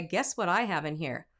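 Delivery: ordinary talk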